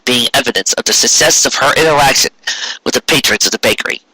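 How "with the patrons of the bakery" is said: usual speaking voice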